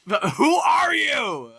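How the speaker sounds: slow, high, drawn-out shout; screaming. Acted. Weapon pointed at the frog, screaming in the common tongue. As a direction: scream it as a demand, stretching the words. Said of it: "screams in co".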